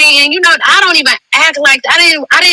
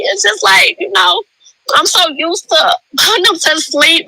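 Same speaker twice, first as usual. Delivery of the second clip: getting emotional; 6 semitones higher than usual. Real, not acted. Mother talking about putting her babies to sleep, getting emotional. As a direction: struggle through it, close to crying. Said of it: "I'm getting emotional".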